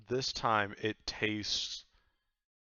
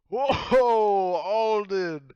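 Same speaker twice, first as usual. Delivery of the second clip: much higher, loud startled cry; group's shocked whoa; 11 semitones higher, 15 dB louder, much slower than usual. Acted. Everyone at the table cries out in shock as Alden vomits, high and loud. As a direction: gasp out his name in shock. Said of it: "everyone at the table ... is like".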